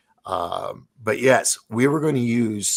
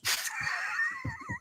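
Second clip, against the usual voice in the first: high, breathy burst of laughter; amused laugh; 9 dB quieter than usual. Real, not acted. Retelling how he bluffed his way in, bursting into laughter. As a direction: laugh at your own cheeky trick.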